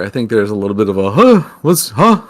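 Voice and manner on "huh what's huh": cartoonish confusion; goofy cartoon voice. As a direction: do a puzzled goofy puppet. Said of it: "a little bit of a goofy cartoon voice".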